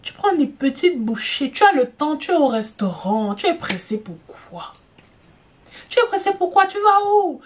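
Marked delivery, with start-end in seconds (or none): 5.9-7.4 much higher, incredulous teasing question; mock exasperated. Scolding a gulping diner at a restaurant, mock incredulous. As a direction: pretend to be shocked at their rush.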